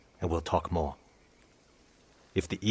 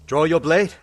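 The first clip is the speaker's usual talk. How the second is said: loud, much higher taunting challenge; 9 semitones higher, 8 dB louder than usual. Acted. Raider challenging the samurai to fight, loud and taunting. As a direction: throw down the challenge at full voice.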